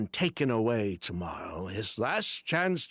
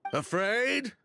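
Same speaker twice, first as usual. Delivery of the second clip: scoffing echo; 11 semitones higher than usual. Acted. Scoffing at being called afraid.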